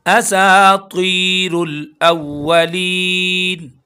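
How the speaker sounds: असातीरुल अव्वलीन very slow, each vowel stretched; drawn recitation. Shown as a demonstration. Teacher models असातीरुल for students, very slow chant. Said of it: "सीन को एक अलिफ के बराबर खींचेंगे।".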